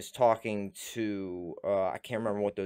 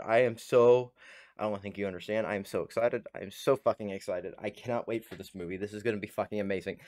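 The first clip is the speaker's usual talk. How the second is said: ordinary talk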